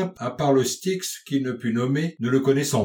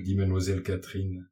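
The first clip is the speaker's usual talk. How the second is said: ordinary delivery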